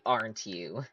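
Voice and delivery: usual speaking voice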